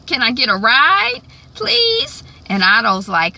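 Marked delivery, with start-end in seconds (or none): 0.1-2.2 high, rising plea; a hopeful upward plea for a ride; pleading ask. Retold or imitated. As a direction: beg sweetly, climbing on please. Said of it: "Ophelia is like".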